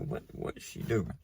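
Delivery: under-the-breath whisper; whispered suspicion. Retold or imitated. Son whispering suspiciously as mom walks off.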